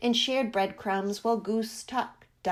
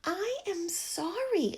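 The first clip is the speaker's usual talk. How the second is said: apologetic little bird; 8 semitones higher, slower than usual. A narrator's reading. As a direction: say sorry meekly, a small bird's voice. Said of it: "apologized the bluebird".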